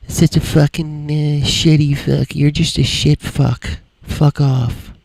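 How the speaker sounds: hammed-up imitation of a viewer cursing him out; mocking an angry viewer. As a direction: sneer it out like the guy who rage quit. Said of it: "It's like".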